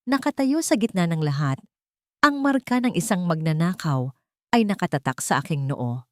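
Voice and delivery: ordinary delivery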